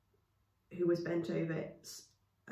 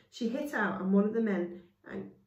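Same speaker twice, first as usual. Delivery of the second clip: plain reading voice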